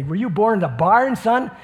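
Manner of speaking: a father chiding his messy son; father scolding. Retold or imitated. Father needling son about his messy room, mock scolding. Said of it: "my father used to say".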